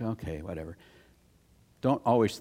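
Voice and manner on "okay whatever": dismissive. Retold or imitated. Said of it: "you know, like".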